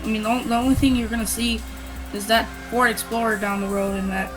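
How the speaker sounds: ordinary talk, nothing marked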